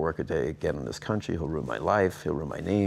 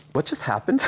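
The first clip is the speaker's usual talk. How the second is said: stunned surprise; 9 semitones higher than usual. Retold or imitated. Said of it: "And I'm like".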